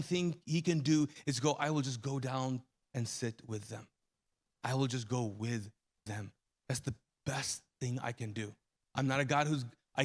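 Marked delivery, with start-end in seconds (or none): 1.5-8.5 tender God voice. Acted.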